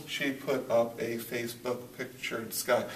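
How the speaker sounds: slow, soft read-through, tapping each syllable; counting syllables. Shown as a demonstration. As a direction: read it slowly and softly, counting out every beat. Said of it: "am I nine syllables?".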